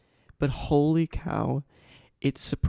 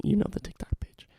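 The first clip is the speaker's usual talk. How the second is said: whisper; 8 semitones higher than usual. Real, not acted. Whispered aside to listeners.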